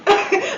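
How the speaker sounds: burst of laughter. Real, not acted. Laughs at own mock question.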